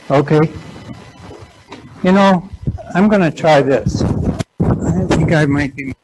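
speaking up for the room. Real, not acted. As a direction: raise the voice to carry. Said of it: "A little louder".

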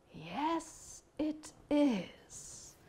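high, very soft turtle voice; old wise turtle. Acted. Unseen old turtle breaks in on bear and angel, high and soft. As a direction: answer softly and high as an old sage. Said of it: "very old and wise turtle".

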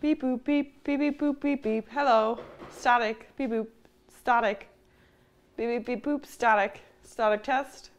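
high beeping robot voice; toy robot voice. Pretend. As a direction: play a little toy robot. Mic test as robot noises.

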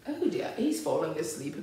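ordinary delivery, nothing marked

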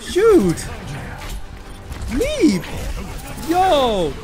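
high, punchy yells; frantic attack shouts. Real, not acted. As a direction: shout each move in the heat of battle.

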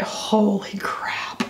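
slow, breathless whisper of awe; hushed awe at a powder; awed whisper. Real, not acted. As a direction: whisper it in disbelief.

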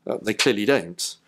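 usual speaking voice

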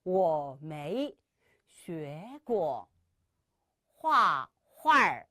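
very slow, tones drawn out; slow teaching read; slow model sentence. Shown as a demonstration. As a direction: model each syllable slowly. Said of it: "시작!".

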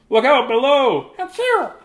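high mock achoo; big sneeze. Star sneezes.

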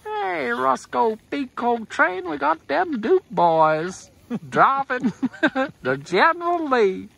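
slow high Southern trucker drawl. Pretend. Dukes of Hazzard radio impression.

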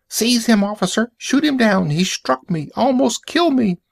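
shrieking accuser, very high; high-pitched rage. Acted. Accuser urging policeman to seize the robot, high enraged screech. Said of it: "The man shook with rage".

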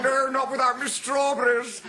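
high, slow, hammed-up shout; indignant retort. Passenger to conductor, indignant mock shout. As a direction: throw it back loudly, drawn out. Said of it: "And he said".

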